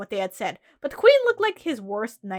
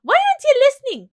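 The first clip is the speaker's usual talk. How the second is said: higher, building to a loud shout; exasperated scolding; 8 semitones higher, 6 dB louder than usual. Acted. Rabbit telling off someone not listening, exasperated. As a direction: scold them, voice climbing to a frustrated yell.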